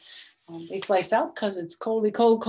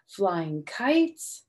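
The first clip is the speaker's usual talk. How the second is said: ordinary delivery, nothing marked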